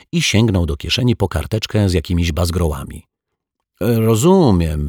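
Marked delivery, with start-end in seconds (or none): 3.8-4.9 slow, high, drawn-out gurgle; gurgled. A narrator's reading. Altar boy finally catching on, gurgling. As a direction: stretch the word with a gurgling swell. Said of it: "wygulgotał".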